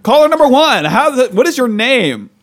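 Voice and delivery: high, loud mock radio host. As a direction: boom it out like a cheesy radio DJ. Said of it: "This is like a radio interview".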